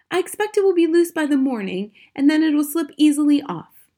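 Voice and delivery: high, loud wifely voice; impatient wife. A narrator's reading. Wife to restless husband in bed, impatient.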